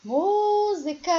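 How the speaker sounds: "Música" sung high with MÚ held; sing-song stretch. Shown as a demonstration. Teacher showing the stressed syllable of Música, sing-song stretch. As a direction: chant "Música", lengthening the first syllable MÚ. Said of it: "o M com U acentuado".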